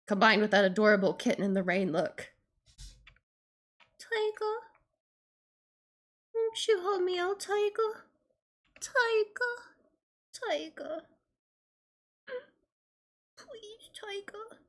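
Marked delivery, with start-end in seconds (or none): 4.0-14.6 put-on pleading girly voice. Pleading voiced character lines.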